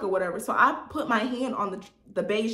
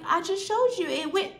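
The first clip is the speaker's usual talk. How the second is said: much higher mock insisting voice; imitated protest; 10 semitones higher than usual. Buyer insists to seller the payment went, mock protesting. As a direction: put on the buyer's high-pitched protest.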